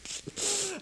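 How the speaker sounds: quick high laugh breaking out; high burst of laughter. Real, not acted. Mocking the dude, a high laugh.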